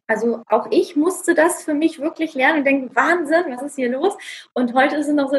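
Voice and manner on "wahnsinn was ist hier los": re-enacted astonishment, pitched up on Wahnsinn; mock-stunned, higher opening word; amazed disbelief. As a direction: act out your own first amazement. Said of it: "ich hätte das nie gedacht".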